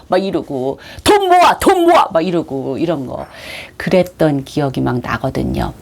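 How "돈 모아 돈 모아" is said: high, punchy repeated order; urgent rallying. Retold or imitated. Organiser telling friends to collect money, excitedly urgent. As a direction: chant it like an overeager organiser.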